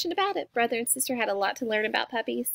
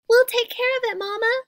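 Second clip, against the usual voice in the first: put-on high, loud child voices; crying out eagerly; 11 semitones higher, 6 dB louder than usual. A narrator's reading. Cubs assuring Mama they'll care for it, eager cry. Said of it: "cried the cubs".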